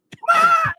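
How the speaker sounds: high, loud mock cry of pain; strangled pained yelp. Retelling a dinner mishap, a pained mock yelp. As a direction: yelp like the salt just stabbed you.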